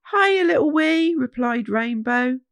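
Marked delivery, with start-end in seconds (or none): 0.1-1.1 loud, higher cheery call; calling back. Acted. Rainbow calls back over the saxophone, loud. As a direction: shout it back over the music.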